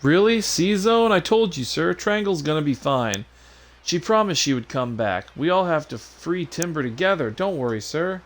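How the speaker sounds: high, excited and relieved; eager sidekick. Acted. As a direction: cheer your comrade on in a high, eager voice. Voices game character.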